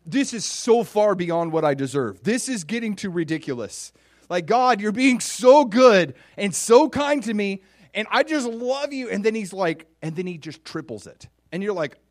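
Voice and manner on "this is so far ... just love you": loud, high, exclaiming to God; overwhelmed by goodness. Acted. Believer overwhelmed by God's goodness, loud exclaiming. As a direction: cry out as if kindness is too much. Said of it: "And David's overwhelmed".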